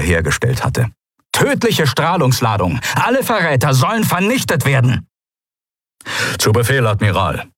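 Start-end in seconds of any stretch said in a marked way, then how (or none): 1.3-5.1 lightly raised admiral's command; calling out orders. Acted. Admiral orders traitors killed. 6.4-7.5 grumbled. Acted. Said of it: "brummte die Stimme".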